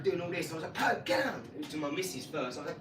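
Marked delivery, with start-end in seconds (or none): 0.7-1.4 sharp high yell of get down; shouting at dog. Retold or imitated. Retelling yelling at his big dog to get down. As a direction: bark the command at a dog.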